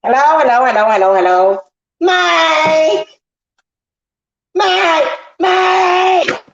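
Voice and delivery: high, drawn-out yell of a name; insistent hellos rising into high calls; calling out. Pretend. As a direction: holler for someone who isn't answering. Calling out to absent Mike.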